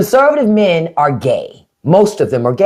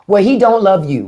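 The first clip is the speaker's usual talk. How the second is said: usual speaking voice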